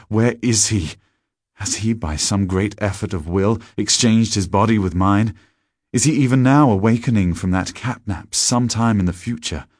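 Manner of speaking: building from urgent to loud and high; anguished, mounting outburst; desperate questioning. Acted. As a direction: let outrage climb with each question. Narrator's frantic questions about stolen body.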